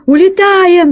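high loud panicked shout. Acted. Rat captain ordering retreat, high panicked shout. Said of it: "закричал крыс".

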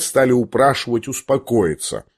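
usual speaking voice

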